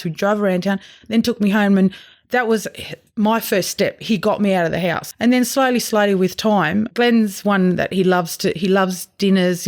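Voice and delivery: normal talking voice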